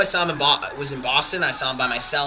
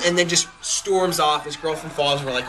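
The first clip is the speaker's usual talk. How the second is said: normal talking voice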